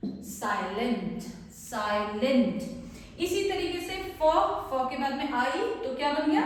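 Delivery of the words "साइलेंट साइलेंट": Silent said slowly with the आय sound drawn out; slow demo word. Shown as a demonstration. Teacher revealing the finished word to students, slow and clear. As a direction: lay out silent slowly for the class. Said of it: "तो ये बन गया".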